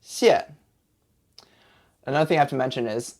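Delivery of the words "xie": "xie" starting high and dropping steeply; falling tone. Shown as a demonstration. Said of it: "with a falling intonation".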